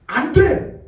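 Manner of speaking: sharp high cry of no; shouted refusal. Acted. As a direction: shout no at the tempter. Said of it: "하고 이야기하는 거예요". (유혹을 물리치며 외치는 안 돼)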